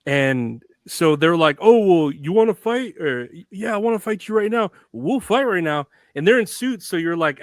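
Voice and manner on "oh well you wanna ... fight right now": raised-pitch mock tough talk; retold wrestlers' challenge, pushed up and punchy; mock fight challenge. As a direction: square up in a light, playful imitation.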